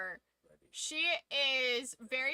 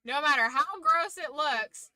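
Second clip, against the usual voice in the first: usual speaking voice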